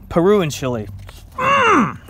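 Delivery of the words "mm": high-pitched mm of dismay; high strangled hum; high squeaky hum. Real, not acted. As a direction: squeak it out in frustration. Pained at wrong answer.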